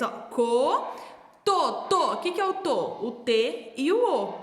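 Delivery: ordinary talk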